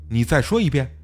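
usual speaking voice

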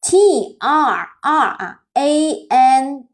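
T R A N S spelled very slowly, letter by letter; slow spelling. Shown as a demonstration. Teacher repeats transaction's spelling for the class, slowly. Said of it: "我再说一次这个单词哈".

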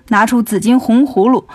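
ordinary delivery, nothing marked

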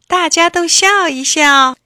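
rally everyone to smile; cheerful call. Acted. Puppet asks the whole class to smile, cheerful.

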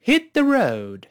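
放慢领读hit the road，调子起得高; 带学生读一遍，road拖长下降. Shown as a demonstration. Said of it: "我们读一遍吧". (慢速带读，高起后落)

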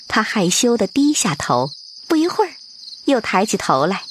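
ordinary delivery

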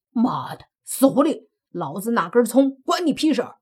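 furious. Acted. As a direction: snap back in a rage. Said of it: "泥巴大怒".

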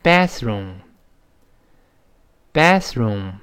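American "bathroom" with the a of cat; slow American "bathroom", front short a; American pronunciation. Shown as a demonstration. Teacher shows learners the American bathroom, American accent. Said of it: "所以美音中这么读".

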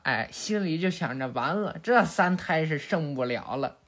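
plain reading voice

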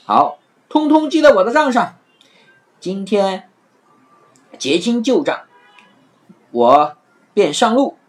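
pleased that the trick worked; happily. Acted. Afanti gleeful after the sign is changed, cheerful. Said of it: "阿凡提开心地说".

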